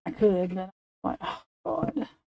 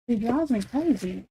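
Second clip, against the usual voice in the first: ordinary talk, nothing marked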